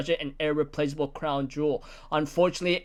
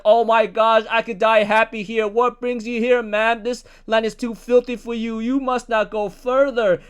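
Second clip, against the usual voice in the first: higher, louder, starstruck gushing; excited gushing; 5 semitones higher, 5 dB louder than usual. Retold or imitated. Island men gushing over Shakuyaku, louder and higher. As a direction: swoon over the idol in a raised, mocking voice.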